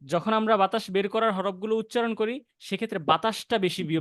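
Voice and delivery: ordinary delivery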